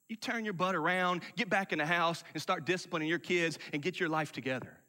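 ordinary talk

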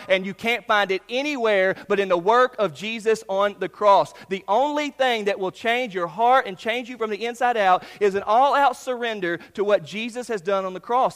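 loud, driving preacher voice; forceful preaching. Acted. Pastor urging the congregation, loud and driving.

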